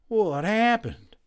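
slow, shaky and weak; dazed and trembling. A narrator's reading. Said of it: "in a shaky voice".

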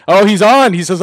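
light up as he spots him joining; excited. Real, not acted. Host sees guest join live.

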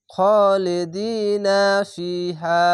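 very slow খালিদিনা ফিহা with each long vowel held; drawn-out recitation. Shown as a demonstration. Teacher joining the phrase for students, slow and drawn out. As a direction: chant খালিদিনা ফিহা evenly, stretching by one alif. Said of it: "জেরের পাশে ইয়া সাকিন এক আলিফ টেনে পড়বেন".

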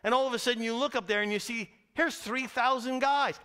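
ordinary delivery

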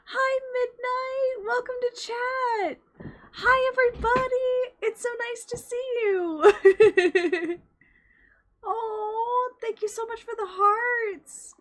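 high, bubbly streamer voice greeting chat; high cutesy greeting. Acted. Streamer greeting chat members, high cutesy voice. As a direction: squeal hello to the chat, slow and sweet.

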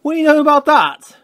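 high, exclaimed wonder; amused astonishment. A narrator's reading. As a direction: marvel at it in a high voice. Percy marvels at Thomas fleeing.

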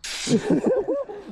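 high-pitched burst of laughter; laughing. Real, not acted. Laughing at the accent bit.

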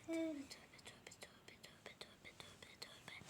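fading whispered repeated tövbe; exasperated whispered litany. Real, not acted. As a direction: chant it under the breath.

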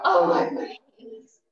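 slow, breathy groan of effort; sore muscles. Real, not acted. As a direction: sigh it out through the ache. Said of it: "It kills, doesn't it?".

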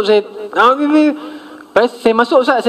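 ordinary delivery

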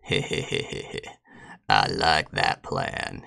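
breathy wheezing cackle through the words; cartoon laugh. Real, not acted. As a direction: giggle it out gleefully, slowly. Cackle after new plan.

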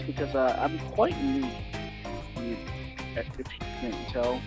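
ordinary talk, nothing marked

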